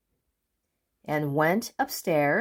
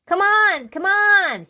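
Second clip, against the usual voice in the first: much higher, louder call; calling cry; 13 semitones higher, 7 dB louder than usual. Acted. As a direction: call through the door. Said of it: "he cried".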